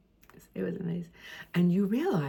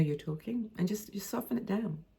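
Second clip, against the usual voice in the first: softened tone; 3 semitones higher, 8 dB quieter than usual. Shown as a demonstration. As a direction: voice dropping much softer. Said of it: "softening the tone now and again".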